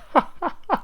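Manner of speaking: high burst of laughter; Holmes bursting out laughing. Acted. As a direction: explode into a laugh. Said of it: "It's all very well for you to laugh".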